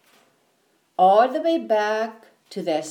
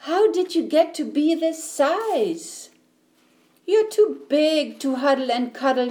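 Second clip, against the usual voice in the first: put-on higher, amazed storybook boy; astonished boy; 7 semitones higher than usual. A narrator's reading. Eddie facing a giant teddy, astonished. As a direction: marvel at the size of it.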